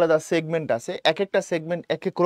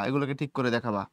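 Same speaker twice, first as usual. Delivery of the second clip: ordinary delivery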